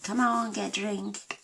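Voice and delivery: high mock coaxing, voicing the dog's side; mock pleading voice. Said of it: "and he's like".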